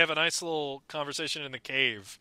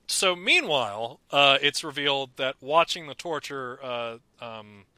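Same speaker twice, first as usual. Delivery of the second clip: ordinary delivery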